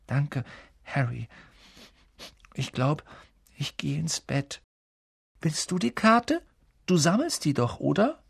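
timid and drained, with pauses; subdued, tearful. Acted. Upset boy thanking his friend before bed, subdued.

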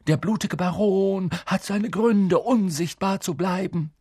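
high, hoarse voice posing as a ghost; put-on hoarse ghostly authority; hoarsely disguised. A narrator's reading. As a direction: feign a commanding phantom, hoarsely. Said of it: "sagte er heiser flüsternd".

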